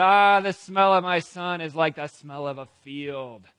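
loud, declaimed father's blessing; exclaimed blessing. Acted. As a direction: proclaim it loudly, as a convinced father. Convinced Isaac blesses his son.

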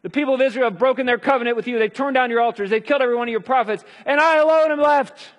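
loud, high, whining complaint; lightly voiced despairing Elijah; Elijah's lament. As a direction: cry out self-pitying like a lone prophet. Preacher acting out Elijah's complaint.

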